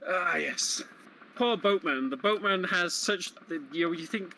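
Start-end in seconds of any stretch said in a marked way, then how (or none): none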